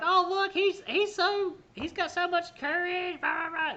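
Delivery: high, put-on gushing voice, slow at the end; mocking onlookers. Bystanders cheering the doomed dog, high mocking coo. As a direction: mimic silly spectators cooing. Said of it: "people are sitting there laughing".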